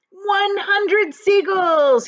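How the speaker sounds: slow, loud, high proclamation; drawn-out triumphant shout. Acted. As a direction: announce the count triumphantly. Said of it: "he shouted".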